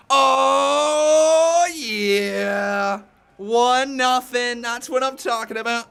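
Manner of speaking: high, loud triumphant cheer; victory whoop. Real, not acted. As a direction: crow over the win. Celebrating a correct prediction.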